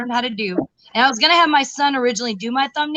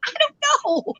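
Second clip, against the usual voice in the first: high sheepish; 11 semitones higher, faster than usual. Retold or imitated. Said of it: "I was like".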